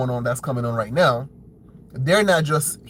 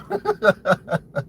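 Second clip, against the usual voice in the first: rapid chuckle in a higher pitch; scornful laugh; 6 semitones higher than usual. Real, not acted. Commentator laughing after naming the opposing side, rapid chuckle. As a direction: chuckle at the very name.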